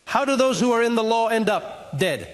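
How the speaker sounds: ordinary talk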